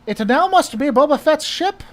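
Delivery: high, loud mock-German officer; high-pitched, forceful German accent. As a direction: order them about in a hammed-up German accent. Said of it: "That's my German accent".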